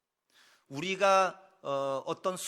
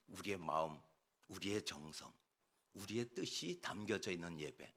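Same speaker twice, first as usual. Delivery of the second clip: low, soft, earnest pulpit voice; hushed, solemn; 8 semitones lower, 10 dB quieter than usual. Real, not acted. Preacher naming what worship must hold, hushed and solemn. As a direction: let each phrase settle quietly. Solemn close of a sermon point.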